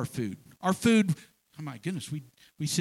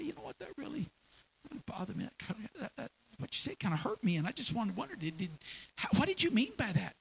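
much softer, careful and sincere; gentle soft confrontation; 3 semitones higher, 6 dB quieter, faster than usual. Pretend. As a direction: ask softly without blame. Gently confronting a friend who hurt you.

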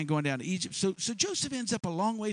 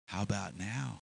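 lower, softer come-on; soft, low invitation; low seductive murmur; 9 semitones lower, 7 dB quieter than usual. Acted. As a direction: hush it like a temptress. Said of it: "and she says".

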